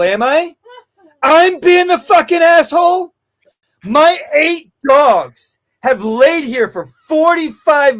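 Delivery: loud, high, rising outrage, slow and hammered on the last lines; sarcastic furious shout at a rude dog owner; furious retold rant. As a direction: throw her insult back at her, shouting. Angry retort in retold quarrel.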